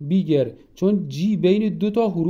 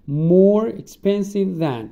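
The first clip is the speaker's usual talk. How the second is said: slow, louder and higher dictation; slow emphatic example; 4 semitones higher, 5 dB louder, much slower than usual. Shown as a demonstration. Teacher drilling students, slow and emphatic. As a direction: drag out More expensive than for the class. Teacher models more expensive than.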